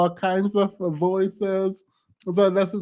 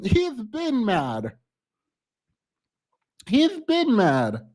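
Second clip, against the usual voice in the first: slow teasing; 3 semitones higher, slower than usual. Real, not acted. Teasing the angry guest.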